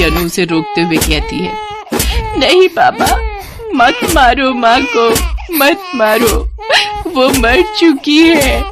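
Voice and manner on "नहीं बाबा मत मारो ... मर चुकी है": crying plea. Acted. Girl begging her father to stop hitting her dead mother, sobbing cry. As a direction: scream through tears to stop him.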